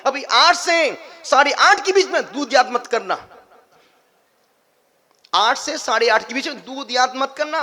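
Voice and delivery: loud, high-pitched sermon delivery; full-force preaching. Acted. Preacher warning followers that every vow gets tested, full force. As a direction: drive the rule home loudly.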